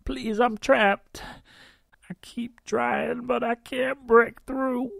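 high, slow, desperate woman's plea; trapped woman pleading. A narrator's reading. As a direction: beg through the wall, slowly.

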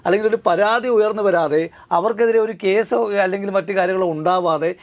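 normal talking voice

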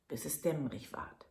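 ordinary delivery

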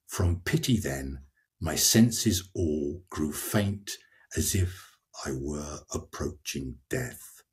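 slow, sinking recital of the translation; dramatic reading. Shown as a demonstration. Reader gives the English of Dante's lines, slow and fading. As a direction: let the voice fall away toward death. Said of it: "The last four lines mean".

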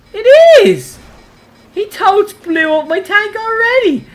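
high, loud exclamation; outraged disbelief. Real, not acted. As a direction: cry out in disbelief at the damage. Finds his tank blown up.

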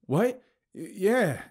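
high, stammered answer; startled reply. A narrator's reading. Narrator answers Abigail's knife question, startled. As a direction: blurt it, caught off guard. Caught off guard by her question.

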